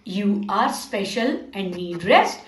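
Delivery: tender slow reassurance; gentle and soothing. Acted. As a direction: soothe them toward rest. Teacher soothing children about rest.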